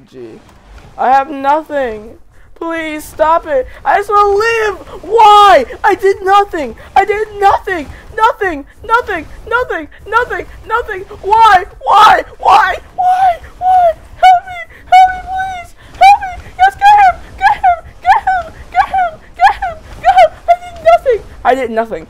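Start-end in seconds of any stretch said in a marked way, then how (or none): 1.0-20.3 very high, loud panicked screams; desperate screaming. Acted. Attacked dinosaur shrieks for help, very high and loud. 20.5-21.2 very high, loud shriek of innocence; screamed denial. Acted. Attacked dinosaur shrieks its innocence, very high and loud.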